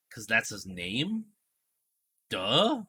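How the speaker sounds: slow, sarcastic duh with a pop on duh; obvious-answer sarcasm, lightly played; mock duh. [name] answering Peaches like it's obvious, mock sarcastic. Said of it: "like that".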